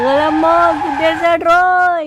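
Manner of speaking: slow, loud, high fake wail of pain; hammed-up wail. Faking a car hit for the driver, a loud hammed-up wail. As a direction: overact being run over.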